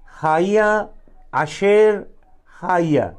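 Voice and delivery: "हाया, अशेर, हाया" in three parts, the first two pitched high; syllable demonstration. Shown as a demonstration. Preacher showing the congregation the three parts, "हाया, अशेर, हाया" spaced apart. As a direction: say "हाया, अशेर, हाया" one word at a time, dropping on the last. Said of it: "सुनिए क्या तीन syllable है".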